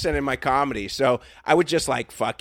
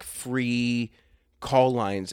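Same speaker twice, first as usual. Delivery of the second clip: normal talking voice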